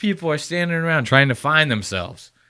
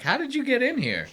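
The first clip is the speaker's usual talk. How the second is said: higher, startled mock question; imitating startled girls, pitched up; mock shock; 9 semitones higher, 5 dB quieter than usual. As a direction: play the girls gasping at an intruder. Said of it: "they're like".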